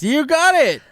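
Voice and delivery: high, triumphant shout of approval; excited cheer. Real, not acted. Celebrating co-host's guess at segue.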